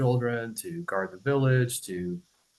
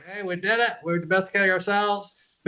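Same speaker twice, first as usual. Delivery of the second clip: mock pride; 8 semitones higher than usual. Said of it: "Everybody say".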